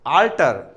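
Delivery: આલ્ટર with an open a, not o; teacher's pronunciation. Shown as a demonstration. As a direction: say આલ્ટર opening clearly on the a. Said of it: "ઓલ્ટર નથી બોલતા". (આલ્ટર ખુલ્લા આ સાથે)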